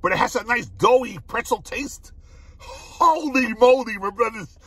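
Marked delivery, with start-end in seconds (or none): 2.6-4.0 slow, loud holy moly; louder, slow delighted exclamation; drawn-out amazement. Real, not acted. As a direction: savour the exclamation slowly. Tasting reaction, drawn out.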